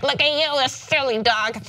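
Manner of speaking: high-pitched talking-to-a-dog voice. Shown as a demonstration. Showing co-host how they talk to a dog, dog voice. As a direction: pitch it up as if addressing a silly pet. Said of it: "that's my, when I look at a dog".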